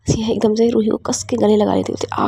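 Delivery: plain reading voice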